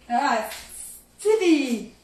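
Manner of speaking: sudden high, loud cry of alarm; startled exclamation. Real, not acted. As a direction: blurt it out in shock. Realizes the marshmallows burned. (cri de surprise aigu et fort)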